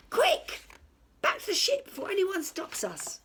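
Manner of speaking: very high, loud hurried pirate voice; urgent pirate. A narrator's reading. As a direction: rush the crew back to the ship. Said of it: "pirate hurries off".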